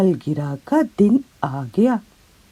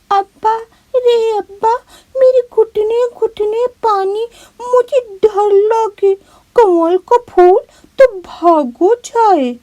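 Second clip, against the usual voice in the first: put-on high, tearful little girl's wail; a scared child crying out to her father from the water; high crying girl's voice, fear rising; frightened crying child; 5 dB louder than usual. Scared girl calls father.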